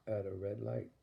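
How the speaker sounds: ordinary delivery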